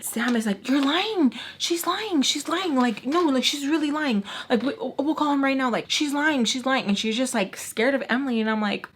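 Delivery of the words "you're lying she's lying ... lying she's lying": high, urgent protesting voice; imitated accuser. Sam disputing the money story before Emily, high frantic accusation. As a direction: mimic her insisting, pitched well up.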